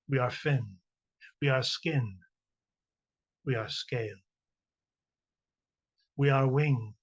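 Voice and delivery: measured, deliberate poetry recital with long pauses; reading a short poem to an audience, spaced and solemn. Acted. Reads her poem aloud.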